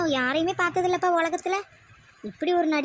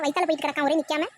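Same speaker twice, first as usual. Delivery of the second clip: ordinary talk, nothing marked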